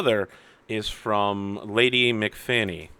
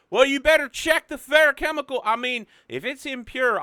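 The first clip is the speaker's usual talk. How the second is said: agitated traveler; 13 semitones higher, 4 dB louder than usual. Acted. Character complaining to water tower man.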